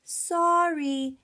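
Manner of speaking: lively, expressive picture-book reading; reading to children. Acted. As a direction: read aloud with big storytelling energy. Reads story to children.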